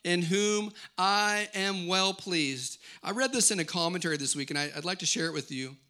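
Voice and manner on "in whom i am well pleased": slow, weighty proclamation from heaven; voice of God. Acted. God the Father declaring over his Son, solemn voice of God. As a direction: declare it word by word, very slowly. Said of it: "A voice came from heaven saying".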